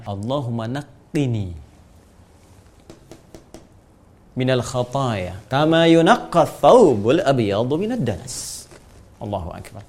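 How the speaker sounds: slow, measured recitation of the supplication; slow devout Arabic recital; Arabic prayer recitation. Shown as a demonstration.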